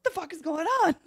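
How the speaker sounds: high, loud, laughing question; laughing disbelief. Retold or imitated. Joey with Slim Jims, a high laughing question. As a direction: laugh out the shock. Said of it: "He's like".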